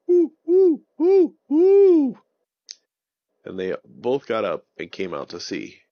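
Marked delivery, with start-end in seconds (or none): 0.1-2.2 high, loud owl hoots; owl hooting. Acted. Owl hooting to wake Avon and Jerry, high loud hoots. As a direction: hoot like an owl waking friends. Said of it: "Ollie the Owl was still there, going".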